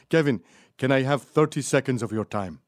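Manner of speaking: imitated Polish accent, earnest request; Polish dude. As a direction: pester politely in a put-on Polish accent. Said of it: "some Polish dude kept following me".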